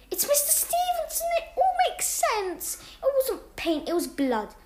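very high, excited gasp of discovery; excited realisation. Acted. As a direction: blurt out the big realisation. Sudden realisation of the culprit.

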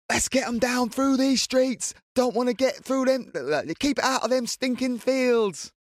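high, slow Essex woman voice; Essex accent. Retold or imitated. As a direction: drawl it like an Essex local. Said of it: "I'm doing, like, an Essex accent".

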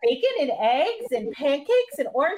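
high-pitched Mickey voice; [name]. Acted. Host reading a boy-band skit in high Mickey voice. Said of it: "I give [name] the high-pitched Mickey voice".

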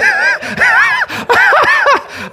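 helpless burst of laughter; high whooping laugh; loud high laugh. Real, not acted. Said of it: "I'm laughing".

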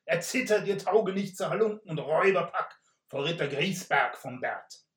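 tinny shout from a helmet. Acted. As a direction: boom the challenge out of a helmet. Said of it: "tönte es blechern aus dem Helm heraus".

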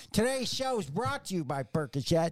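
a touch of an ad announcer; mock ad read; mock sponsor read. Joking sponsor plug for the show, ad voice.

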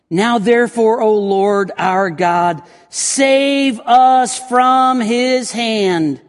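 slow, loud, drawn-out prayer reading; dramatic scripture reading. Acted. Preacher reading Hezekiah's prayer from the text, slow and loud. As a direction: stretch every word like a plea.

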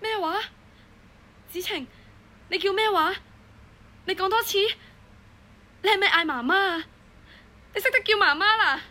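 tender, rising amazement; overjoyed disbelief. Acted. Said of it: "嗰一刻我真係開心到乜都唔記得晒".

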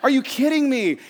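high, loud, incredulous outburst; hammed-up indignant yelling; boiling-over frustration. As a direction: act out blowing up in disbelief. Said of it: "my blood just boiled over. I am so frustrated".

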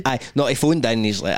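ordinary talk, nothing marked

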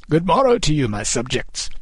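high regal greeting, falling away; king calling out. A narrator's reading. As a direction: hail the crowd as their monarch. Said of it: "the king called".

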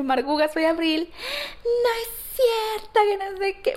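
high, excited fan voice; starstruck greeting. Retold or imitated. Introducing herself to her idol, high and giddy. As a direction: gush it out, overjoyed.

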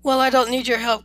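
ordinary delivery, nothing marked

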